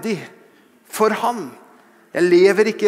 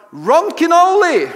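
shouted high and loud, like a rallying cry; bold shout of a name; 10 semitones higher, 5 dB louder than usual. Retold or imitated. Roommate shouting his battle cry, loud and bold. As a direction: belt the name out boldly. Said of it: "så ropte han".

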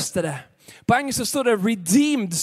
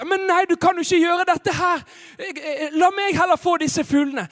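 high, urgent, raised voice; alarmed protest; 8 semitones higher, 3 dB louder than usual. Acted. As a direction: plead indignantly with the boy to stop. Pastor protests to boy. (opprørt, innstendig protest)

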